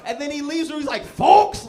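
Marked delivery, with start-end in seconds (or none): 1.0-1.7 mock call to the crowd, high and loud; blabbing announcement. Comic plays the leaky man blurting the secret, high loud call. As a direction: spill it instantly like a town crier.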